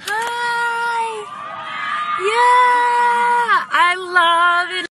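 high, loud excited shouting. Real, not acted. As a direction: cheer at the crowd. Greeting fans excitedly.

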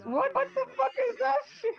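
squeaky outrage. Acted. Greeter balks at the script.

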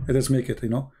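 ordinary talk, nothing marked

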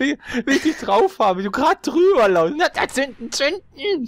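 laughing. Real, not acted. Teasing teammate, laughing.

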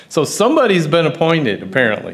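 high, loud, amused exclamation; amused disbelief. Real, not acted. Board member joking about the nameless appointment, amused disbelief. Laughing over his own unclear appointment.

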